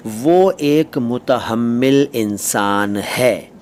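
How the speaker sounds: slow, spaced-out teacher's example; drawn-out demonstration sentence. Shown as a demonstration. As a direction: say it slowly, word by word.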